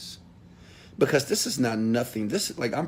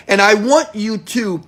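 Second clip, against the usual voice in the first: ordinary delivery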